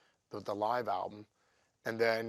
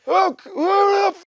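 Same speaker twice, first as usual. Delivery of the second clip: high, loud mock [name] yell; hammed-up angry [name] outburst; imitating Oz shouting; 14 dB louder than usual. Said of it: "He goes".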